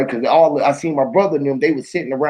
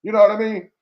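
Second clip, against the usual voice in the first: usual speaking voice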